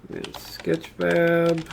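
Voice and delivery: ordinary delivery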